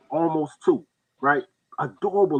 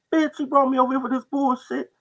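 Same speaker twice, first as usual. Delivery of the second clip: angry cousin; 11 semitones higher than usual. Retold or imitated.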